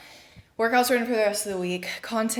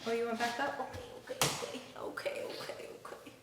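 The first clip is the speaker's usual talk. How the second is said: soft soothing; 4 semitones higher, 11 dB quieter than usual. Real, not acted. Soothing the baby softly.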